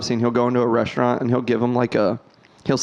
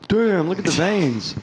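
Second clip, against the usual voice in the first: mock gawking commenter; 6 semitones higher than usual. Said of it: "Just like".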